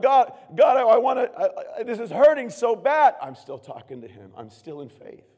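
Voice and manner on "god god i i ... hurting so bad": high, loud pleading cry; hammed-up hurting prayer, much louder; anguished cry to God.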